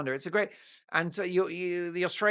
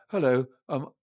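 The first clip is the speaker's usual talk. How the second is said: mock Prince Harry; 4 semitones lower than usual. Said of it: "his negotiating line is".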